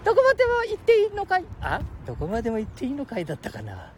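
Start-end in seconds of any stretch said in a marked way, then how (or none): 0.0-1.4 put-on high squeaky boy voice; cheeky little kid, much higher; Ken-chan voice.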